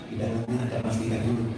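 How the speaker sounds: very soft, low, rapid aside; mock whisper. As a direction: slip them a secret, hushed. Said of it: "Tapi saya bisikin". (bisikan sangat pelan dan cepat)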